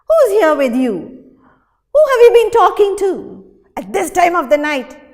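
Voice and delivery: loud, high, fast accusing shout; jealous husband yelling; furious jealous shout; angry shout. Acted. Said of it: "he shouted".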